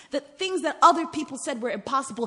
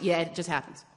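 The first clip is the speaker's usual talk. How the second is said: mock blasé; 7 semitones lower, 3 dB quieter than usual. Mock blasé present reaction.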